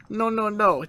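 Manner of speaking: high, hasty protest; imitating a flustered denial; mock flustered denial. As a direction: backpedal in a playful panic. Heroine hastily denying a trick.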